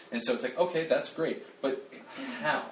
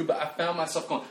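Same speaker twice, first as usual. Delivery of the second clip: ordinary talk, nothing marked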